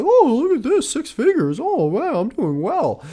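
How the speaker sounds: high, mocking smug voice; mock smug gloat. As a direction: gush like someone impressed by peanuts. Mocking a smug underpaid host.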